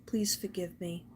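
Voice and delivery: soft whisper of "please forgive me". Retold or imitated. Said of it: "Somebody wants to tell you".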